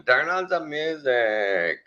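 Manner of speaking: high, very drawn-out plea; drugged demand. Retold or imitated. Groggy patient on the ride home, slow drawn-out demand. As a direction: stretch it out like someone doped up.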